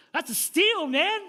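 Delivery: high, loud thrilled exclamation; loud, high mock amazement; excited disbelief. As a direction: retell being thrilled by a bargain. Excited reaction to discount offer.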